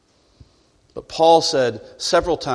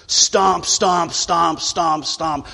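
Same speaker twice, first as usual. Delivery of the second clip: stomping noises; 2 semitones higher, much slower than usual. Acted. Preacher mimics a child stomping off to clean. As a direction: voice the footsteps of a sulking kid.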